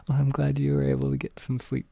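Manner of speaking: normal talking voice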